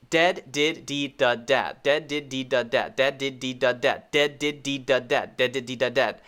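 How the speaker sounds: rapid-fire run of dead, did, deed, dud, dad; quick rhythmic tongue drill, no gaps; very fast drill. Shown as a demonstration. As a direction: rattle the five words off in repeated loops. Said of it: "You can say them very, very fast".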